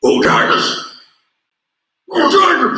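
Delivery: raspy Cajun coach voice, the second cheer shouted up high; raspy Coach O impression. Host imitates Coach O's raspy game day cheer. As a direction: growl it out like the LSU head coach. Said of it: "he has this very unique way of saying".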